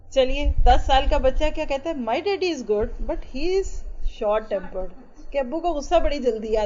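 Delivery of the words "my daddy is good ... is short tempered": put-on slow child quote. Voicing ten-year-old child.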